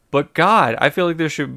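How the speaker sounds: loud, high exasperated groan of the word; a loud, high, real exasperated "God"; exasperated outburst. Real, not acted. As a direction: let the frustration burst out. Exasperated movie review.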